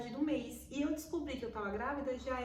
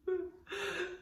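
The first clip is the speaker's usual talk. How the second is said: laughing cry; 9 semitones higher than usual. Real, not acted. E chorando.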